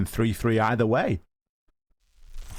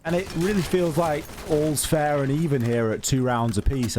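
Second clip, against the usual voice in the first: ordinary delivery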